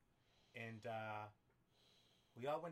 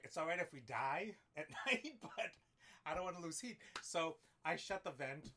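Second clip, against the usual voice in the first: usual speaking voice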